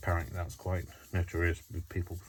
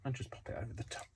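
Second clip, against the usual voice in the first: soft aside; 5 semitones higher, 11 dB quieter, faster than usual. Real, not acted. Quiet murmured instruction.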